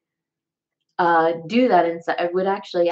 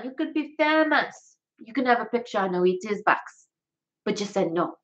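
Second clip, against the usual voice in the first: high, animated Jamaican accent; playful put-on Jamaican voice; 7 semitones higher than usual. Shown as a demonstration. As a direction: act a film scene in Jamaican accent. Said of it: "here's my Jamaican accent".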